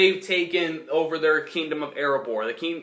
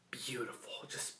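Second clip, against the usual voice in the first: breathy hush of admiration; awed whisper; 4 semitones lower, 19 dB quieter than usual. Real, not acted. Reviewer whispers praise of the dragon, awed whisper. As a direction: whisper it like you're in awe.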